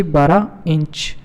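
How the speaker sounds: "बारह inch" drawn out slowly, each word weighed; slow emphatic answer. Shown as a demonstration. Teacher giving the quiz answer to students, slow and emphatic. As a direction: spell out "बारह inch" slowly so the class remembers it. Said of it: "तो कितनी होनी चाहिए?".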